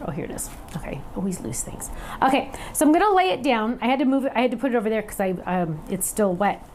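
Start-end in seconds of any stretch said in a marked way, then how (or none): 0.1-1.9 soft whisper to herself, much softer; whispered aside. Real, not acted. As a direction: whisper it under your breath while hunting for it. Muttering to herself while searching.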